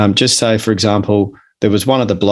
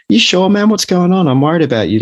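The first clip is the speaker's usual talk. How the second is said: a mate's worried questions, pitched much higher; concerned mate; 9 semitones higher than usual. Retold or imitated. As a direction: lean in as the worried friend, voice up high. Caring mate asks about anxiety.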